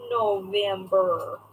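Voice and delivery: mock Midwestern Minnesota twang; Minnesota accent. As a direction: draw out the vowels in a Minnesota accent. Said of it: "said by somebody who lives in Minnesota".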